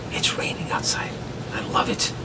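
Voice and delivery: hushed whisper over the rain; soft whispered aside. Real, not acted. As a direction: whisper so the rain stays audible. Quiet listening to rain.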